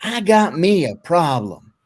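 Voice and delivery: loud, drawn-out North Carolina hillbilly "well"; put-on Appalachian drawl from North Carolina; North Carolina mountain accent. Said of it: "he was from the mountains of North Carolina".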